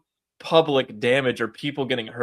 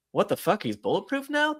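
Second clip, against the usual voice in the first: mock shocked agents; 6 semitones higher, 4 dB quieter than usual. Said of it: "they're like".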